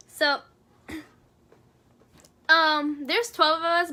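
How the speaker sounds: ordinary talk, nothing marked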